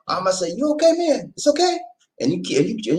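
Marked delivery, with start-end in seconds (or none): 0.5-1.8 high, pointed mock concern; a polite question that is really a threat; mock warning. To someone acting the fool, mock concern as warning. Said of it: "That's a warning".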